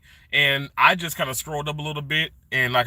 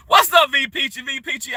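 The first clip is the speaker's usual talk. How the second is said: high, loud excited hello; excited greeting; 11 semitones higher, 7 dB louder than usual. Real, not acted. Streamer greeting a viewer in chat, excited and high. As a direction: call out a friend's name with delight.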